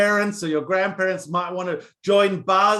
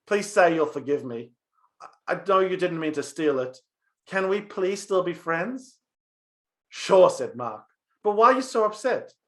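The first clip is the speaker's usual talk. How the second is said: put-on lively storyteller reading a children's tale; story narration; 5 dB louder than usual. A narrator's reading. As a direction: tell it like a bedtime story for kids. Rabbi reads a story aloud.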